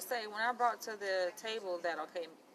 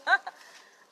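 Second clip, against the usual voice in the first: loud burst of real laughter; 11 semitones higher, 13 dB louder than usual. Real, not acted. Student laughing at the professor's dare, loud real laugh. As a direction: break into a big loud laugh.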